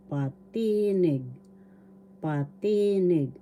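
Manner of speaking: "patinig" with a long, stressed ti; stress on second syllable. Shown as a demonstration. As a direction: stretch the middle ti of "patinig" for learners. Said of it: "The accent is in the".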